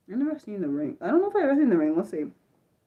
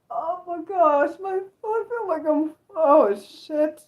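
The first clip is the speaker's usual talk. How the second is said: frightened whimper; 7 semitones higher, slower than usual. Real, not acted. Frightened by horror image.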